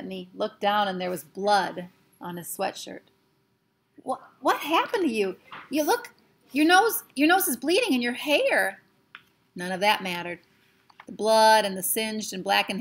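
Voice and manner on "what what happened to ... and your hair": high, shocked and frightened; alarmed boy. Acted. Patrick horrified at Omri's bleeding nose. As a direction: gasp it out in horror at his friend's face.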